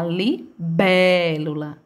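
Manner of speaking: "libélula" with BÉ held long and punched; long emphatic BÉ in "libélula"; dragged stressed syllable. Shown as a demonstration. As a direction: pause after li, then stretch BÉ in "libélula". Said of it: "a sílaba que mais se arrasta".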